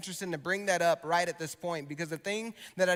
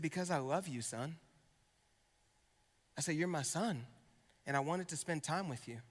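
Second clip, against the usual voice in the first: tender; 4 semitones lower, 9 dB quieter than usual. Retold or imitated. Tender words to his son.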